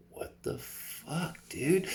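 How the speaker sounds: a breathless, unvoiced gasp of disbelief rising high to "dude"; stunned half-whisper that jumps up at the end; amazed. Telling friends about spotting Trinity, with stunned disbelief. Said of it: "like, fully amazed".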